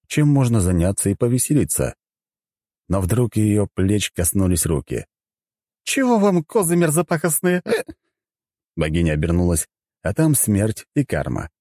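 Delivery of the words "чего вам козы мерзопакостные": high, irritated tipsy voice; grumpy drunk goddess. Acted. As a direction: grumble at the intruders. Goddess snaps at visitors.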